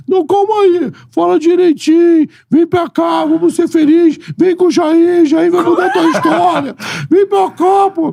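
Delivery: high-pitched pushy boss impression; Eurico persuading. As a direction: coax him like a pushy club president. Eurico voiced persuading on phone.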